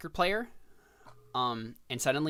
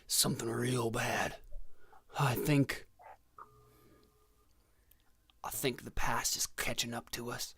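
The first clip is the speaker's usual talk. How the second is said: ominous whisper. Acted. Pancho whispers ominous warning.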